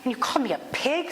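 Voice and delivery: mock-offended, incredulous question; retold indignant disbelief, rising at the end. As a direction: act out a driver's affronted protest. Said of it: "He's like".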